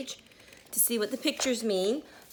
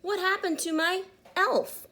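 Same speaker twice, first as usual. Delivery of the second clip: mock-upset shout about the broken elf; 11 semitones higher than usual. A narrator's reading. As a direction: cry it out in alarm. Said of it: "shouted Mister Grumpy".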